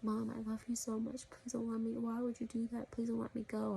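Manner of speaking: put-on a touch of a tearful girl begging mom; pleading daughter. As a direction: beg as the daughter not to be let go. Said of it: "And like".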